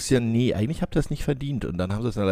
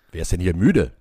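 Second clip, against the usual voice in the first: ordinary talk, nothing marked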